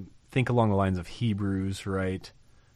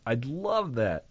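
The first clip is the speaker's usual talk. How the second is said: softly savoring; 4 semitones higher, 3 dB quieter, slower than usual. Real, not acted. Repeated softer, slower.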